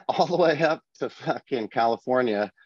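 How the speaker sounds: laughing through the words; amused, chuckling while telling it; breaking into a laugh mid-sentence. Real, not acted. Laughing at dumb sailors story.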